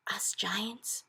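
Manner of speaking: hushed giant's aside; very soft, breathy-voiceless words; whisper. A narrator's reading. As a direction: whisper it confidentially. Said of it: "the B F G whispered".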